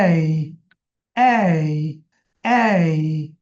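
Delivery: low, spaced-out এই for the class to repeat; sound drill. Shown as a demonstration. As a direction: repeat এই with a pause after each. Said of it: "উচ্চারণ করবেন কিন্তু সাথে সাথে". (থেমে থেমে এই উচ্চারণ)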